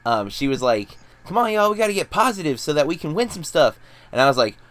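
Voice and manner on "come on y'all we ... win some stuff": high-pitched mock cheerleader rallying; cheerleader pep talk. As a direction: imitate a peppy coworker rallying the group, pitched up. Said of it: "like, cheerleader".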